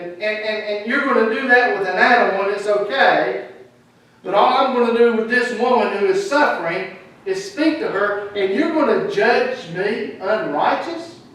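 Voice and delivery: loud, high indignant rebuke of hypocrites; Jesus rebuking. Acted. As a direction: preach it at full force as Jesus scolding the ruler. Preacher voicing Jesus rebuking hypocrites.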